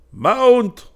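high, ringing shouted command; loud cry. A narrator's reading. Falconer to the riders in the walled yard, crying out. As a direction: call it out sharply across the yard. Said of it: "cried a voice in Breton".

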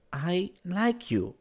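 put-on slow, high, deliberate girl's voice. A narrator's reading. Girl confesses to him, speaking slowly. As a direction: draw out each word. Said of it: "she said slowly".

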